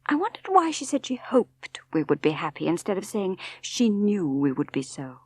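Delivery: plain reading voice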